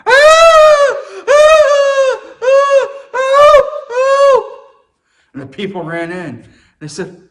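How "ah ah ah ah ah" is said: much higher, much louder repeated shrieks; mock screaming. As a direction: shriek like a woman crying for help. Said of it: "she started screaming".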